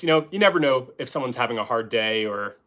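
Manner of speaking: ordinary talk, nothing marked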